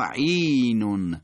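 عینون as "ʿīīī-nun", not "ʿayn"; slow recited عینون with long vowel; elongated vowel. Shown as a demonstration. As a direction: stretch the opening ī of عینون. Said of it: "حرکت کشیده هست".

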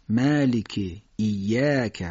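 "مالک، ایاک" with thin, fronted, drawn-out "a"; careful Arabic vowel demonstration; stretched fatha. Shown as a demonstration. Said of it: "باید فتحه رو بکشیم".

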